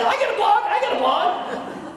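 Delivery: high, laughing mock excitement; excited. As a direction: squeal it like a thrilled kid. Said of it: "You're all excited".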